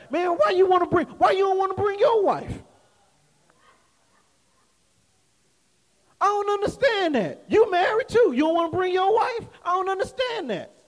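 high-pitched imitation of puzzled coworkers; baffled protest. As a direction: play the incredulous coworker, voice lifted high. Retold coworkers baffled about bringing wife.

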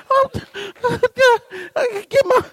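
high, broken whimper; gasping, half-crying false starts; hammed-up exhaustion. Husband too spent to ask wife again, mock whimpering.

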